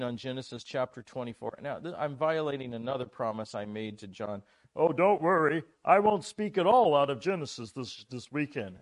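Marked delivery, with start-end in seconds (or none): 4.8-8.8 loud, higher-pitched quote of himself; hammed-up reassurance to a colleague; breezy mock assurance. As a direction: replay an overconfident promise, loudly. Preacher replays his promise to John.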